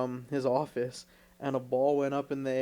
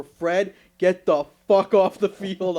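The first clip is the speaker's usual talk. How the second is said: mock angry yell, louder; coach yelling; 3 semitones higher, 9 dB louder, slower than usual. Coach storming out at Fred, mock angry yell. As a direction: bark it like an irate coach. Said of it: "Here he comes right out the front door".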